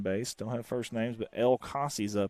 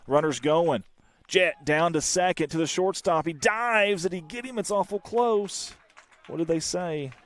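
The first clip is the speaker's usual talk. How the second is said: louder and higher, Boston-free rush of a close play at second; excited play call; 7 semitones higher, 6 dB louder than usual. Real, not acted. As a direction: call the steal like it's the big moment.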